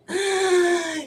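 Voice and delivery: high yelping gasp; sharp gasp. Real, not acted. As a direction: gasp as if caught off guard. Sudden gasp.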